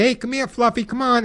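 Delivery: high, cheery call to a dog; bright sweet call to a pet. Retold or imitated. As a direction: sing it out sweetly to lure the pup. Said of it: "You don't want to call the dog and say".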